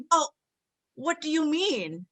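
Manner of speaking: retold reply that takes a joke personally; taking it personally. Telling how she questions her boyfriend, taking it personally. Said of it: "I take it personally".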